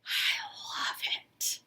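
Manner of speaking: breathy whispered aside, slow. Real, not acted. Whispering to viewers about a loved pattern. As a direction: hush it as if moved.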